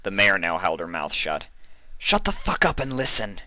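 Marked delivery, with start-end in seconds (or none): 2.0-3.4 higher, sharp angry bark; curt furious command. Acted. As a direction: snap the order at her. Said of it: "she barked".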